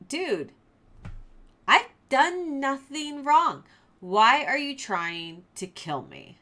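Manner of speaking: high, loud and slow, calling out; shouting plea. Retold or imitated. David shouting back across to Saul, pleading loudly. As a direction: shout it across, hurt and baffled. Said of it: "Saul recognizes David's shouting".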